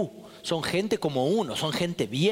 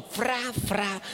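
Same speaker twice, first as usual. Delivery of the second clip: higher mouthed whooshing thwacks; imitated belt lashes; 4 semitones higher than usual. Relative re-enacting the cousins' belt fight, imitated lashes. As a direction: voice the swish of swinging belts.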